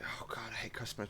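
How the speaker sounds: hushed, breathy aside of weary dread; whispered grumble. Retold or imitated. Train driver to viewers, a whispered grumble about customers. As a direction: whisper it like a private moan. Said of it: "most days I'm like".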